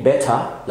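BETTER as "bet-tah"; British better, crisp T; British clear T. Shown as a demonstration. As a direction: hit a true T and drop the R in better. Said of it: "في البريتش إنجلش بنقول".

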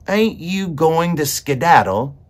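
high, loud, slow character voice; coaxing question. A narrator's reading. Meg wants to let the mouse go, coaxing loudly. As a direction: prompt it slowly, pitched high.

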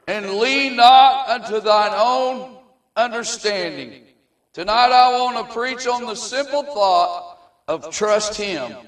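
slow, booming scripture reading; preaching. Acted. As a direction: declaim the verse slowly.

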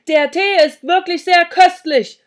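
loud, high shout; over-loud, hearty holler; bellowed compliment. A narrator's reading. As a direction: shout praise to a hard-of-hearing hostess. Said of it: "brüllte ich".

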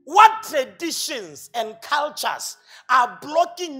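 drawn-out, loud, every word weighted; booming slow rhetorical question; slow emphatic challenge. Real, not acted. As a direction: lean hard on each word like a challenge.

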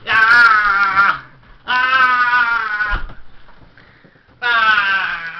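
loud hammed-up battle cries; fight yells. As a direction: yell as you throw the punches. Grandpa brawling with Sonny.

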